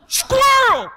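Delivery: very high, sudden yelp; distracted outburst. Believer following God gets distracted like the dog, high yelp. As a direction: blurt it out as if spotting one mid-thought.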